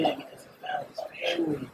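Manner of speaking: soft unvoiced whisper; whispered son's voice. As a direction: whisper it like a crying boy explaining. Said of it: "He's like".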